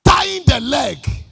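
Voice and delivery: loud, high sermon cry over music; preaching at full force. Acted. Preacher crying out to the congregation over music. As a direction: preach it out, rising and urgent.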